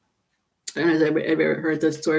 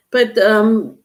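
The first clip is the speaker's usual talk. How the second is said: normal talking voice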